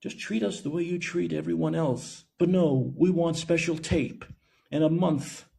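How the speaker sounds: high, soft imitation of a plea; mock pleading. Mimics group's plea, then demand.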